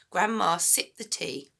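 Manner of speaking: ordinary delivery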